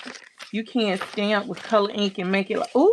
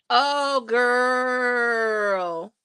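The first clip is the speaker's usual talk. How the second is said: excited cry; 3 semitones higher than usual. Real, not acted. Host cries out to her chat, overjoyed. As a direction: let it ring out in delight.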